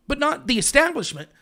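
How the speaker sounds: ordinary delivery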